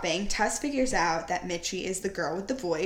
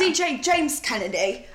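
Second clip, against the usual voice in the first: a light mock British accent on the name; lightly imitating a trashy British accent; hint of James Kennedy British accent; 4 semitones higher, 4 dB louder than usual. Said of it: "a James Kennedy British accent".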